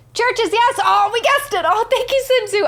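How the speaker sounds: high, excited burst; overjoyed, high-pitched thanks; real delighted excitement. Real, not acted. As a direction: cry out high, thrilled to have guessed right. Her guess about the band confirmed.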